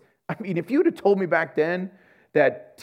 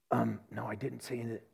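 small, much softer mumble of someone caught out; sheepish; 6 semitones lower, 12 dB quieter than usual. Retold or imitated. A believer quizzed about his prayer, answering meekly. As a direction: shrink back and admit it meekly.

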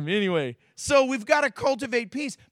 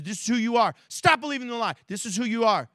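normal talking voice

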